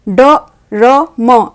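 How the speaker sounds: very slow, each letter apart; letters read one by one. Shown as a demonstration. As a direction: spell out ड र म slowly. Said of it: "डर्म होगा या drum.".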